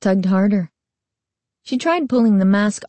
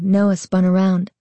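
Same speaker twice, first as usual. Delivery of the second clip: ordinary delivery, nothing marked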